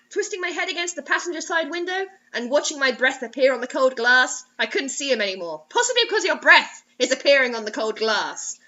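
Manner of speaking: usual speaking voice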